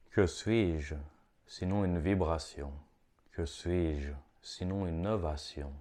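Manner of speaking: slow devotional verse, drawn-out "Oh"; poetry reading. Acted. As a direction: recite it like a meditation, letting each phrase fall away. Reads own poems aloud.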